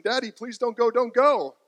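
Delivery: pleading child imitation. Daughters clinging to dad's arm, mock high tearful pleading. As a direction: beg him not to leave, high and loud. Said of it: "Grabbing onto your arm, saying".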